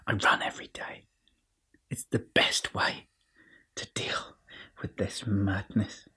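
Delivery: hushed, confiding whisper; whispered confession to the listeners; whispered aside. Acted. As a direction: whisper it like a secret. Confiding aside about running.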